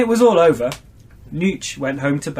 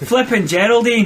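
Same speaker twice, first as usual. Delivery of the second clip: higher Scottish character voice; Scottish exclamation; 6 semitones higher, 3 dB louder than usual. A narrator's reading. Nooch realising the kilt is left behind, Scottish exclamation. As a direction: cry it out in Scottish. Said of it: "he exclaimed".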